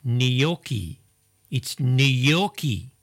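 gnocchi slowly as nee-YOK-ee; proper pronunciation. Shown as a demonstration. Host correcting co-host, gnocchi slowly as nee-YOK-ee. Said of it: "proper way is gnocchi".

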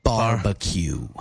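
drawn-out, over-enunciated word; slow exaggerated mouthing. Shown as a demonstration. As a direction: stretch each syllable for lip reading.

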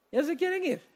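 preacher teasing the congregation who want to leave, high and playful; high mock teasing. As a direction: tease them in a high, amused voice. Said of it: "tienen ganas de salir corriendo".

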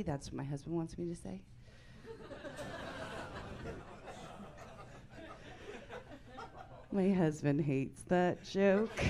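ordinary talk, nothing marked

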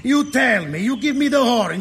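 normal talking voice

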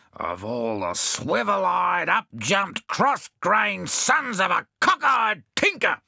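slow, rising tirade of insults; exasperated rage, drawn out and building; boiling with rage. A narrator's reading. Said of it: "boiling with rage".

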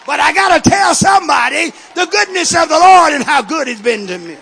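loud, rising testimony, building to a shout; full-force preaching. Real, not acted. Preacher testifies why he must talk about Jesus, at full force. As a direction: preach it out with mounting fervor.

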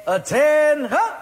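first sergeant calling recruits to attention, barked shout; drill sergeant shout. Retold or imitated. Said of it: "I'm a drill sergeant".

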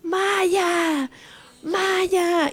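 high drawn-out calls for a lost dog; retold frantic calling for a lost husky; calling out her dog. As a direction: call her name across the town, retold. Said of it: "como loca llorona gritando".

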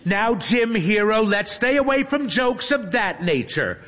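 put-on high, scolding girl voice; admonishing. A narrator's reading. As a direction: gently tell him off. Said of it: "Hermione admonishes him".

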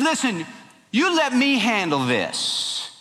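high-pitched, drawn-out instruction; taking charge. Acted. Queen tells sulking king she will handle it, taking charge.

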